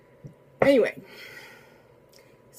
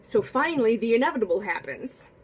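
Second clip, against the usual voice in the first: ordinary delivery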